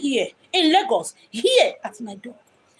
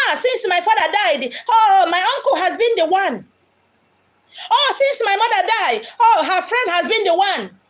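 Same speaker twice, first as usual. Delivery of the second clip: lightly voiced, higher, laughing mimic of a naive orphan; sing-song parody of someone praising the uncle; mock grateful orphan; 6 semitones higher than usual. The medium mocks her listeners, imitating them in a higher, laughing voice. Mimicking people who befriend killers.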